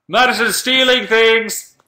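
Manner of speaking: high, slow, loud call-out; mock alarm shout. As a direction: raise the alarm on a thief, hammed-up. Mock-denounces a chat member, then apologises.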